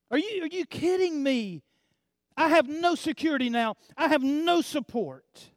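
a widow crying out in disbelief; grieving widow. Acted. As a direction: protest like a bereaved widow. Widow's protest voiced.

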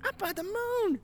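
imitated very high-pitched kid's yell; excited child yelling. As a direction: cry out like a thrilled little boy. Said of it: "He goes".

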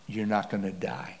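hushed and low, gentle inner voice; still small voice. Retold or imitated. God's inner voice reassuring him under the gun, soft hush. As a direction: barely breathe it, calm and sure. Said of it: "this little still small voice".

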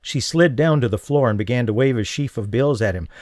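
usual speaking voice

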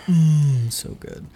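savoring hum, then breathy so good; appreciative mmm. Real, not acted. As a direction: relish the taste in a hum. Savouring rosé memory.

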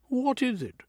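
alarmed. Acted. Wife's alarmed question.

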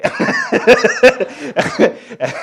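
loud, high burst of laughter; long, high peal of laughter; real belly laugh. Real, not acted. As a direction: crack up helplessly at your own story. Tickled by his son's consoling.

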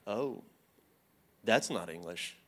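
low, soft dawning realization; hushed realization. Retold or imitated. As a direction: let it quietly sink in. Quietly dawning realization in retold thought.

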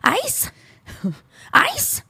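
disbelief piling up at no ice; but ice? said in mounting disbelief; incredulous. Real, not acted.

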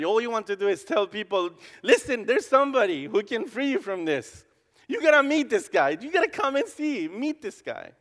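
rising to a high, loud, fervent plea; excited urging. Acted. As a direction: build to a passionate shout of invitation. Preacher urging people to meet Jesus.

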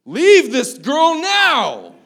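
hammed-up exorcist roar at the daughter; mock exorcism shout. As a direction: command the demon out of a child in mock fury. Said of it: "I'm like".

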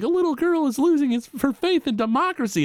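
high-pitched mock urgent caller; alarmed caller. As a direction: quote the caller in a high, hurried voice. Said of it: "the guy calls up".

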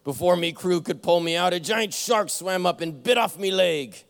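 hammed-up pirate captain telling his tale; captain tells the new crewman his story, in a pirate voice. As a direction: ham up the salty old pirate. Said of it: "This is my pirate voice".

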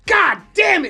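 very high, loud outburst; frustrated shout. Retold or imitated. Retold reaction to the Yankees losing, frustrated shout. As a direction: explode like a fan whose team just lost.

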